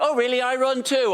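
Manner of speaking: high, eager small talk; keen fellow runner. Acted. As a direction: pounce on the shared hobby. Acted keen fellow runner at party.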